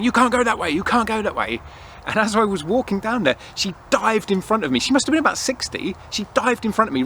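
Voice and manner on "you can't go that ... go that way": high-pitched mock yell of a fussy attendant; retold shouting. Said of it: "she was shouting at me".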